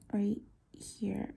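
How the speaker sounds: soft unvoiced whisper to self. Real, not acted. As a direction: whisper while concentrating. Concentrating on careful detail work.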